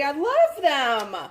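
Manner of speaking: gushing. Real, not acted. Thanking Sherry, gushing.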